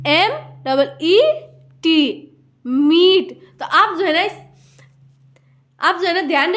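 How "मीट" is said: मीट with a drawn-out ee; long ee. Shown as a demonstration. Teacher reading a double E word, stretched मीट. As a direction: hold the vowel in मीट before the t. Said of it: "दीर्घ उच्चारण होगा".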